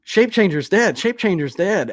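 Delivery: high, loud alarmed shout. Acted. Two gnomes shouting news as they run past, shouting. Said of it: "they're, like, shouting".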